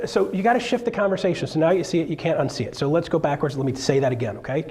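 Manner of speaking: ordinary delivery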